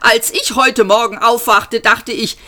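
high, aggrieved lament; indignant, self-pitying wail; wailing complaint. A narrator's reading. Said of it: "jammerte Arthur".

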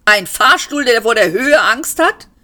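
high, rising questions in disbelief; incredulous exclaiming. A narrator's reading. Passenger mocks a lift afraid of heights, incredulous. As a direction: scoff loudly at the very idea. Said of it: "rief Seyfort".

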